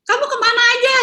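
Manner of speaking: high, prying question to a late husband; suspicious wife. Retold or imitated. Wife demanding where her husband was, suspicious mock nagging.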